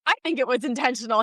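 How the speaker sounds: normal talking voice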